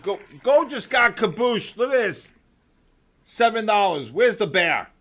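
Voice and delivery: loud, high disbelieving outburst; excited outcry. Real, not acted. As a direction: yell in shock at the chart. Gold price crash, loud exclaiming.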